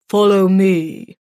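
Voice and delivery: slow, loud, hollow butler voice; hollow and grave, drawn out. A narrator's reading. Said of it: "he said in a hollow voice".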